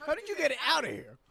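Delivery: high, shouted comic disbelief; hammed-up incredulous shout; mock outrage. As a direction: yell the question in pretend horror. Mock outraged parent, escalating.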